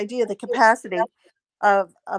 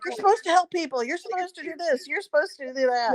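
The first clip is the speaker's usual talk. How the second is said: higher-pitched mock insistence; imitated demands; 6 semitones higher, 3 dB quieter than usual. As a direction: imitate people piling on expectations. Voices people making demands on her.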